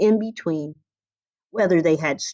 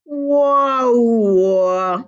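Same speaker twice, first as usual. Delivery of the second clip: trombone wah wah; 3 semitones higher, 4 dB louder than usual. A narrator's reading. Trombone showing off to Violin with a loud sliding wah-wah. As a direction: sing it like a trombone slide.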